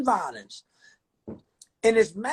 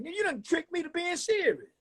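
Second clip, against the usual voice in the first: fool character voice; 7 semitones higher, faster than usual. Pretend. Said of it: "let me go back to playing my fool ass character".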